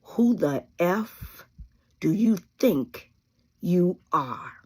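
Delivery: slow, drawn-out put-down; slowly, each word a challenge; defiant, slow comeback to people who take you for granted. Pretend. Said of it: "saying to these folk".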